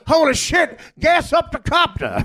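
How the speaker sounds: mock panic from a fleeing pundit; panicked shout. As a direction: yell for the chopper in a panic.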